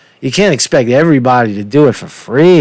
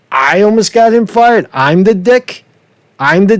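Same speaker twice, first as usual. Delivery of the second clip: indignant disbelief; 6 semitones higher than usual. Real, not acted. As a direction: throw the accusation back in disbelief. Indignant at boss's accusation.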